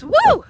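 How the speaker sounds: loud high whoop; joyful whoop. Real, not acted. Joyful cry.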